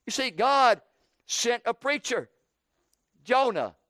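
normal talking voice

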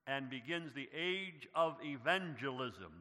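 usual speaking voice

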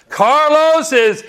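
climbing high on innocent; preaching. Acted. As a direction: proclaim it to the congregation.

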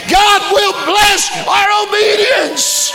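high, loud, shouting preacher climax; preaching at the top of the lungs; full-force preaching. Acted. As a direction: lift the church with a soaring hallelujah shout.

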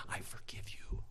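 soft, whispered imitation of mom; hushed forgiveness. Mom's forgiveness that brought happiness, whispered. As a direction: let it out in a tender whisper.

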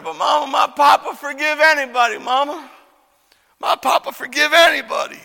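put-on high, loud pleading boy; crying child. Little boy pleading with mama for forgiveness, high cry. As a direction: plead loudly, voice pushed up high. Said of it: "went to his mama crying".

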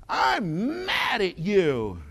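put-on slow, drawn-out little-kid huff; mock pouty anger. Little girl tells preacher off, mock pouty anger. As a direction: draw out MAD like a cross small child. Child acted out declaring anger.

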